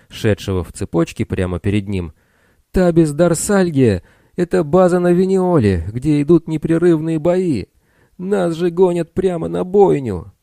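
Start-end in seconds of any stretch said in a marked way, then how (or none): 2.7-10.3 panicked shout. A narrator's reading. Bill panicking in the chain.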